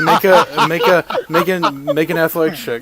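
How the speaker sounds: high, loud, through laughter; laughing too hard to finish. Real, not acted. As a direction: stammer the instruction while cracking up. Game master cracking up at escape.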